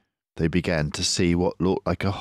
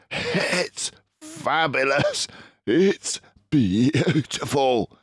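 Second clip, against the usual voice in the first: slow, higher marvelling voice; awed Mister Fox; 9 semitones higher, slower than usual. Acted. Mister Fox marvels at the cider, breathless. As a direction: savour each word.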